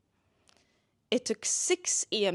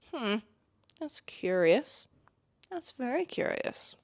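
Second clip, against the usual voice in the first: mock suspicion; 5 semitones higher, slower than usual. Mock-suspicious musing on the clues.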